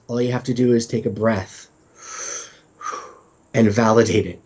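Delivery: normal talking voice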